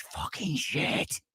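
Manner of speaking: breathless whispered swear under the breath; whispered curse. Real, not acted. Reviewer fresh from the theater whispers the curse to viewers, breathless whisper. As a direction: let the last word drop to a whisper. Nearly losing it after the film.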